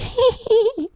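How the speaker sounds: very high-pitched squealed fake laugh; put-on squeal of laughter; bad funny laugh. Shown as a demonstration. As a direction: demonstrate an annoying squeaky laugh. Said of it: "don't do that kind of laugh".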